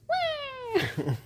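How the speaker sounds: tiny, very high-pitched "wah"; failed yell. Retold or imitated. Wife mocking her own try at yelling into a pillow, failed yell. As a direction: imitate a feeble attempt at yelling. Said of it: "tried to yell in the pillow".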